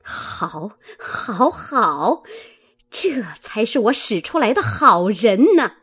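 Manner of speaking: sarcastic praise, swelling into anger; bitter angry sarcasm. A narrator's reading. Mistress scolds her treacherous servant, bitterly sarcastic. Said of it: "骂道".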